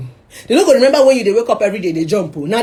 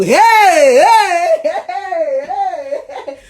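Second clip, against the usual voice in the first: mocking hey laugh; 8 semitones higher, 3 dB louder, much slower than usual. Mocking judgmental people, laughing.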